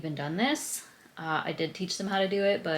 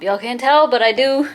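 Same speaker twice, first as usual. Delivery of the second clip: excited; 5 semitones higher, 13 dB louder than usual. Real, not acted. Proud about her quad pump.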